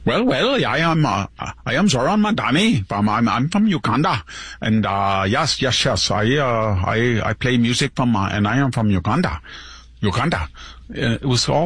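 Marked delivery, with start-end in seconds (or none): 0.0-10.5 mock Ugandan accent with swooping pitch; exaggerated fake Ugandan accent, halting and repetitive; Zoran Mamdani. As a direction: mimic a put-on Ugandan accent, mockingly. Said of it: "that accent they had".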